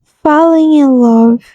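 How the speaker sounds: "falling in love" dito devagar e com cuidado, em inglês; English demo. Shown as a demonstration. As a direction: pronuncie "falling in love" bem devagar, sílaba por sílaba. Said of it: "eles falam". (professora mostrando como se diz "falling in love" em inglês)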